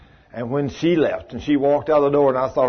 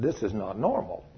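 hushed concern; 5 semitones lower, 10 dB quieter than usual. Retold or imitated. Recalls quiet, grave reply.

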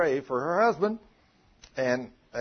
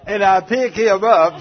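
laughing retelling; 5 semitones higher, 12 dB louder than usual. Retold or imitated. Woman laughing retelling her reaction.

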